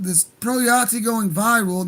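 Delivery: usual speaking voice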